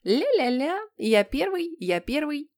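put-on high singsong child's taunt; joyful gloating. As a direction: crow it like a kid dancing with glee. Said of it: "принялся радостно приплясывать". (радостная детская дразнилка высоким голосом)

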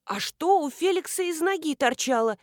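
high, frightened question; trembling voice. A narrator's reading. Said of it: "дрожащим голосом осведомилась Нина".

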